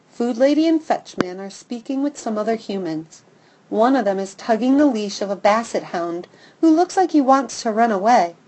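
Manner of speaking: ordinary delivery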